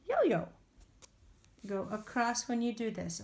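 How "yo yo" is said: yo-yo said with a high, bouncy pitch; bright high yo-yo. Shown as a demonstration. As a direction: sing out yo-yo on a high note. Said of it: "modeling a word".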